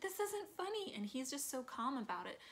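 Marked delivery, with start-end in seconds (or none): 0.0-0.9 much higher, alarmed objection; a flash of retold panic; panicked protest. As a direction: Marta pleading in a panic. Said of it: "She's like".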